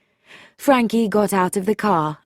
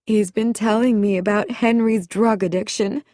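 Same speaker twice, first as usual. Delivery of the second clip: higher-pitched, mildly distressed; a touch of upset, voice lifted; tearful; 4 semitones higher than usual. A narrator's reading. Sylvia tells Frankie of her husband's addiction, tearful. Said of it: "she was crying".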